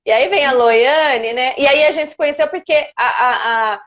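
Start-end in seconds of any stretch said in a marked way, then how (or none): none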